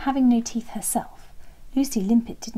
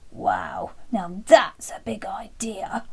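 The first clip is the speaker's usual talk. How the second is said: impressed dinosaur; 3 dB louder, much slower than usual. Acted. Dinosaur reacts to the plan.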